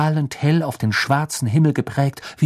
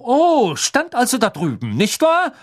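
high, mocking interrogation; exaggerated, scornful question; sarcastic disbelief; 10 semitones higher, 3 dB louder than usual. A narrator's reading. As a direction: sneer at her story. Crouch mocks Hermine's account.

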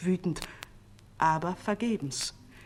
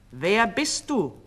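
slow, raised cynical question; 4 semitones higher, 5 dB louder, slower than usual. A narrator's reading. False king challenging his double, cynical. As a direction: draw out a cool challenge, a little louder. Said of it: "fragte er ihn zynisch".

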